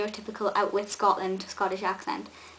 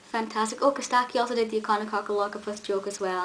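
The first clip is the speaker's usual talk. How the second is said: ordinary talk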